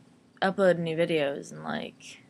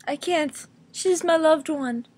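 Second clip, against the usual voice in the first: higher, slow hammed-up plea; mock pleading; 7 semitones higher, 4 dB louder than usual. Answering her own demand, slow higher mock plea. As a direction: beg like a soap opera character. Acted back-and-forth.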